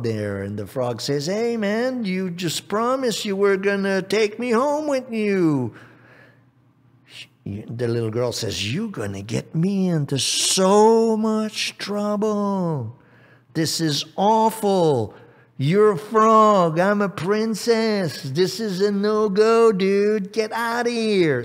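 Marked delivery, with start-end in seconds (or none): 1.3-5.7 high, indignant frog voice; acted high-pitched grievance; protesting frog. 8.7-21.4 high, drawn-out outraged princess; big acted fit of indignation; princess tantrum. Princess rails at the frog.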